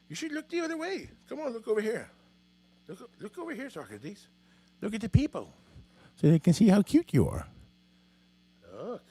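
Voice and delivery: high, soft pet-talk voice; coaxing a pet. Real, not acted. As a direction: coax the cat in a high little voice. Coaxing the cat toward camera.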